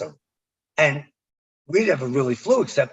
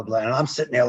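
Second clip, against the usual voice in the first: ordinary talk, nothing marked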